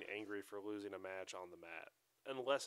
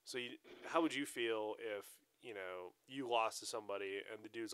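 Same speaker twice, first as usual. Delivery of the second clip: usual speaking voice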